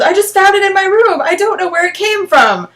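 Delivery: high, loud, pleading; panicked protest. Acted. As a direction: keep protesting, rattled. Smokey keeps protesting after yelling.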